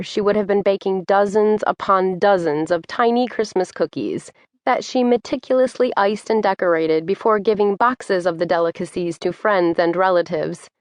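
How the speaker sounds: ordinary delivery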